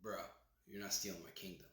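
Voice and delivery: low, hushed confrontation; Scar. Retold or imitated. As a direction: challenge them under your breath, speeding up at the end. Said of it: "Well, Scar's like".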